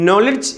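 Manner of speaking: നോളഡ്ജ് with short "no" and "lich"; correct pronunciation. Shown as a demonstration. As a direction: say നോളഡ്ജ് as "NOL-ich". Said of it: "ഒരിക്കൽ കൂടി".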